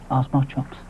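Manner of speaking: plain reading voice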